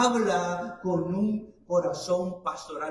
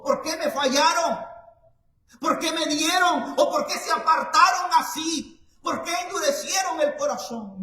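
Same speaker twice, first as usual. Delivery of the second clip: reproche de juez severo, alto y agudo; 8 semitones higher, 8 dB louder than usual. Pretend. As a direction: imita a un juez que reprocha. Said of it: "no llegó y dijo". (Dios imaginado como juez severo que reprocha)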